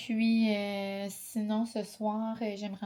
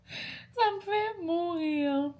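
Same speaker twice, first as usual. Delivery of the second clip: laughing; 9 semitones higher, much slower than usual. Real, not acted. Laughing at the chatbot reply.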